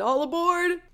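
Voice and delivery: high, drawn-out boarding call; train conductor call. Retold or imitated. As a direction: imitate a conductor calling out to passengers. Imitates call at the train station.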